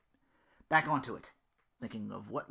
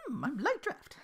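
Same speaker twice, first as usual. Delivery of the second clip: laughing; 13 semitones higher, 3 dB quieter than usual. Acted. Belle jokes, laughing.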